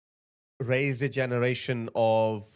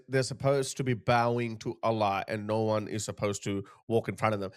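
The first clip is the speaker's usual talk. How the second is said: ordinary talk, nothing marked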